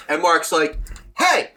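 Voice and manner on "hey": sharp angry hey. Retold or imitated. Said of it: "I didn't hear that "hey".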